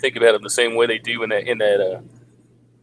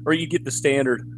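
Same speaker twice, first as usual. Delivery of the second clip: usual speaking voice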